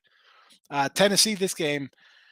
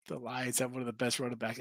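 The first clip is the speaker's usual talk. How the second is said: much softer, sarcastic repeat of "The Lions have one of the best running backs."; mocking echo; 5 semitones lower, 12 dB quieter than usual. Host teasing his co-host by parroting his claim, sarcastic. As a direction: murmur the claim back as a dry joke.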